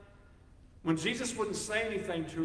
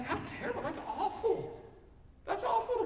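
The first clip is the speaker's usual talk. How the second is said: mock horror; 13 semitones higher than usual. Real, not acted. Mock shock after the dog story.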